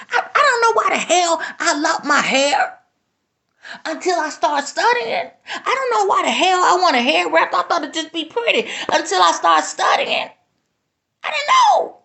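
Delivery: high, tearful voice breaking through a laugh; real crying while confessing. Real, not acted. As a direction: fight back real tears as it rises. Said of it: "I'm gonna try not to cry".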